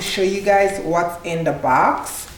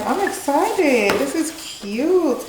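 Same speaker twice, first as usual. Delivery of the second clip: high, drawn-out delight; excited; 7 semitones higher, slower than usual. Real, not acted. Vlogger gushing to viewers over the box, excited. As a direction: stretch the words out high with real excitement.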